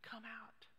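very soft, near-whispered call; hushed summons. Acted. Preacher puts the question to the congregation, hushed summons. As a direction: invite them out under the breath. Soft echo of Jesus' command.

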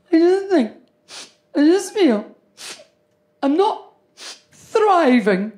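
high, drawn-out mock-tragic daughter voice; whiny daughter. Daughter tells her mother she isn't thriving, in a high whiny voice. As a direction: play the self-pitying twenty-year-old, high.

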